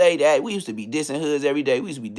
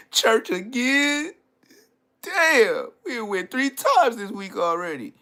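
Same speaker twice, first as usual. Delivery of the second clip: put-on dismayed kid; 8 semitones higher, slower than usual. Boy hearing his mom say church, high dismayed voice. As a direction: protest like a kid dragged along once more. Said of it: "I'm gonna be so depressed".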